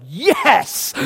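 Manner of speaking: high-pitched triumphant yes; exultant burst; gloating cheer. Acted. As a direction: cheer in mock victory. Gloating reaction he would have had.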